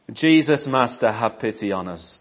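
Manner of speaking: ordinary delivery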